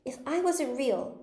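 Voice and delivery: high, slow, mildly tearful; half laughing through tears. A narrator's reading. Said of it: "half laughing through her tears".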